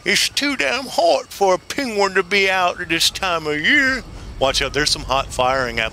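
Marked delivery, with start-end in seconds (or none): 0.1-4.0 high voiced penguin line; quoting the penguin clip. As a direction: say it like the cartoon penguin. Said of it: "find the one where it says".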